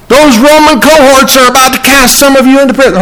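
high-pitched hypothetical quote in a put-on voice; acted, high mock accusation; mock alarm. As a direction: pitch it way up as a mistaken claim. Voices what scripture does not say.

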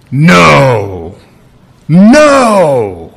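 long, forceful "No" each time; emphatic no. Acted. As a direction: stop a toddler with a firm, drawn-out "No". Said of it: "You're emphatic".

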